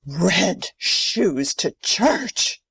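slow, drawn-out disbelief; appalled. Acted. Grandmother dwells on Karen's offence, appalled. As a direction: stretch each word in scandalised emphasis.

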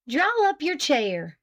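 high, slow, sharp order. A narrator's reading. Wife giving in and ordering husband to eat, sharp. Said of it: "she said sharply".

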